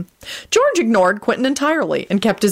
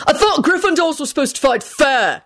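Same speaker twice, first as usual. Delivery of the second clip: shouting down; 10 semitones higher than usual. Acted. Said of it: "he shouted down at Harry".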